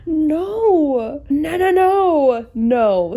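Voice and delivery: high, loud, drawn-out refusals; loud horrified no. Real, not acted. Vlogger to viewers, rejecting an awful cover, loud and high. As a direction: cry out against what you see.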